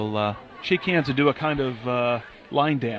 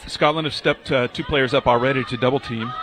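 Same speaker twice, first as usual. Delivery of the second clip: play-by-play; 8 dB louder than usual. Real, not acted. Live match play-by-play.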